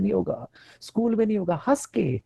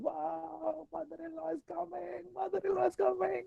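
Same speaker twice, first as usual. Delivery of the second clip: high, slow sarcastic cheer; mock delight; 10 semitones higher, 13 dB quieter, much slower than usual. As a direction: fake joy through gritted teeth. Fake cheer at mother-in-law. (नकली खुशी, ऊँची धीमी आवाज़)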